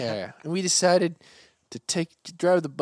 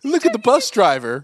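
taunting shout; 10 semitones higher, 11 dB louder than usual. Pretend. Playacting kids mocking bus driver.

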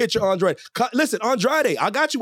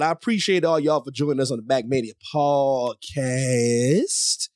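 slow, lower announcer read; show host intro; 5 semitones lower, much slower than usual. Acted. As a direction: introduce the guests grandly.

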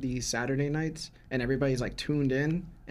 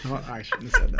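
laughing; 5 dB louder than usual. Real, not acted. Embarrassed after a slip.

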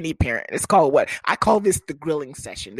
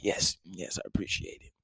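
low, soft, suave thanks; deepened voice; 10 semitones lower, 11 dB quieter than usual. Acted. Boyfriend thanking mama, very low soft voice. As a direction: murmur it deep and dignified. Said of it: "I tend to deepen my voice".

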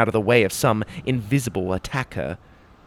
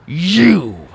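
growled; 7 semitones higher, 6 dB louder than usual. Acted. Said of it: "I growled".

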